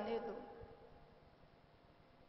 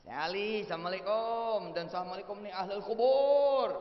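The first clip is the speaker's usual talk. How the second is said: hearty called-out greeting; 4 dB louder than usual. Shown as a demonstration. Visitor hailing Ali and the grave dwellers, calling out. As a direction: hail the whole graveyard, stretching each final vowel. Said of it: "N U yang setuju begini nih".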